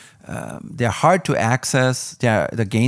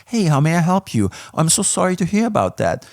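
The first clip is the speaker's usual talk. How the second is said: higher, bubbly mock customer-service voice; chirpy American service rep; 7 semitones higher than usual. Mocking American support staff, a mock chirpy helpdesk voice. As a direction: play an overly eager helpdesk agent. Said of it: "That is an American thing".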